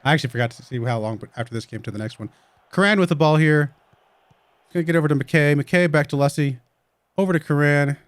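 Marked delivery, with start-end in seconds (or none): 2.7-8.0 lively match commentary; play-by-play. Acted. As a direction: call the game as it happens.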